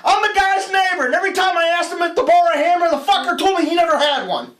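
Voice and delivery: high, loud, fast indignant rant; old man ranting. Acted. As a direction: spit out the grievance like a bitter neighbor. Old man's punchline rant.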